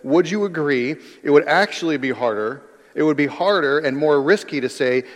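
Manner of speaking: usual speaking voice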